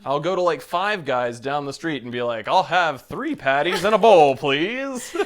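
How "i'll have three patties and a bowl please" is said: booming anchor voice ordering food; news anchor voice. Retold or imitated. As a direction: order lunch like a broadcaster. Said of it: "always sound like a damn news anchor".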